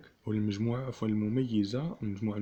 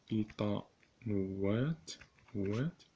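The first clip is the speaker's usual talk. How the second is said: ايطانوات drawn out very slowly, syllable by syllable; slow dictation; 4 dB quieter, much slower than usual. Shown as a demonstration. Teacher dictating to students, ايطانوات said very slowly. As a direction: let the class write it down.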